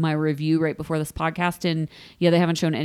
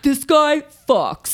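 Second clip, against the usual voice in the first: slow, higher mock Aussie; attempted Australian accent; 7 semitones higher, 4 dB louder, slower than usual. Host voices the flower guy, shaky attempt at Australian accent. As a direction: have a poor go at an Australian accent. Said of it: "I can't do an Australian accent".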